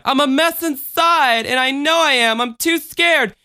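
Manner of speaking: put-on high-pitched little-girl cry; distraught child. A narrator's reading. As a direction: cry out like an upset small child. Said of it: "the distraught child cried".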